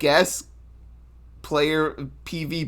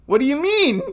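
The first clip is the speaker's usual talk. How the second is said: high, startled confusion; confused squeak; 13 semitones higher, 6 dB louder, faster than usual. Retold or imitated. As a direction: squeak it out, baffled. Confused player in retold exchange.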